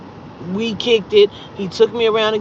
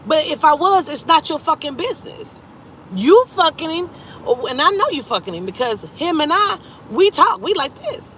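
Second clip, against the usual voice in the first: higher-pitched territorial comeback; defiant retort; 6 semitones higher than usual. Retold or imitated. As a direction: put the rival in her place, pitch raised. Retold retort to the other woman.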